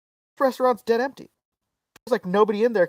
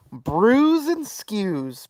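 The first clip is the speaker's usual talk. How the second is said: slow, savoured announcement of a name; drawn-out name reveal; 4 semitones higher, much slower than usual. Real, not acted. Storyteller revealing the restaurant's name, slow and drawn out. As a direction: draw the name out for comic effect.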